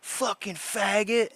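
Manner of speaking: high, slow sneering slur in a put-on voice; imitating a troll. Retelling the troll leaning out of a car, mock sneer. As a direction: mimic the passing troll's sneer. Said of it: "and he goes".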